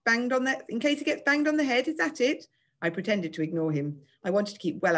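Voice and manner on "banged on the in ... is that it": high, singsong taunt; pitched-up, babyish mockery; jeering. A narrator's reading. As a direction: keep ridiculing him in mock concern. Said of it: "Giles jeered".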